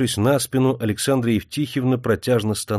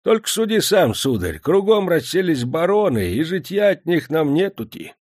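put-on old fisherman voice; 8 semitones higher than usual. A narrator's reading. Fisherman complains about barons.

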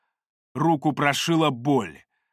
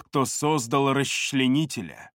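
ordinary delivery, nothing marked

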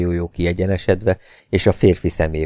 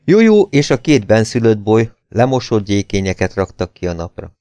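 ordinary delivery, nothing marked

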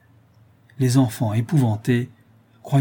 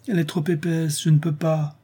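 lightly voiced higher-pitched child; a hint of a helpless little boy; child's complaint; 6 semitones higher than usual. A narrator's reading. Boy failing to break the ice, complaining. Child answers his mother.

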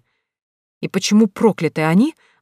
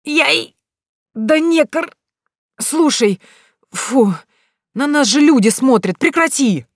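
high pained yelps; yelping in pain; 8 semitones higher, 4 dB louder, slower than usual. Acted. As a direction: squeal after being zapped. Zapped groom yelps and pleads.

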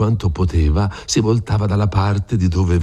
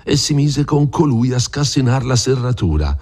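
ordinary delivery

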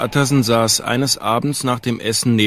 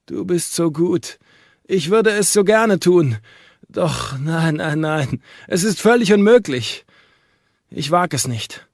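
higher, lightly voiced sick man, torn between longing and dread; a lonely invalid who wants company but dares not; frail doctor; 5 semitones higher, 3 dB louder than usual. Sick doctor at the window refusing his friends, higher, lightly voiced.

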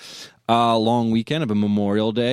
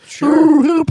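underwater voice; 5 dB louder than usual. Acted. Underwater voice bit continues.

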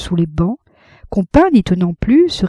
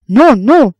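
crowd protest; 6 semitones higher, 6 dB louder than usual. A narrator's reading.

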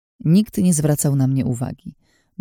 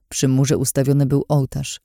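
ordinary delivery, nothing marked

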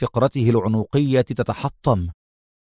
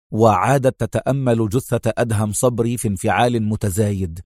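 ordinary delivery, nothing marked

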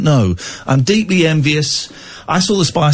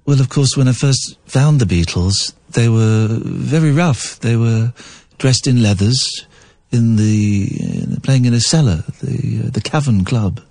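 posh Epstein impression; 5 semitones lower than usual. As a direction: play Epstein recalling the [name], posh and measured. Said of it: "play Brian Epstein speaking".

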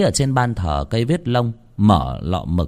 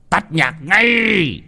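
angry shout; 10 semitones higher, 4 dB louder, much slower than usual. Acted. Master stops the loud party.

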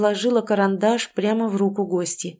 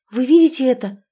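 higher, louder, startled question; urgent question; 5 semitones higher, 7 dB louder than usual. A narrator's reading. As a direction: ask it loudly, needing them to see it too. Startled girl asks the others.

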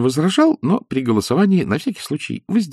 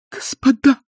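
возмущённый возглас; 3 dB louder than usual. Acted. Елена взывает к гостям.

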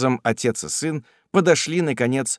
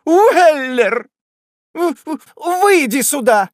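high hysterical shriek; stammering shrill summons; 7 dB louder, slower than usual. A narrator's reading. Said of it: "закричала".

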